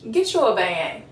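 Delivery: ordinary talk, nothing marked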